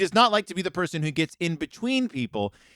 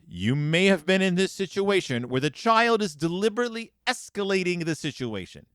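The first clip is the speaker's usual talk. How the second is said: ordinary delivery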